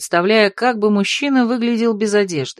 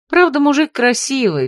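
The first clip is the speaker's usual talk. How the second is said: ordinary delivery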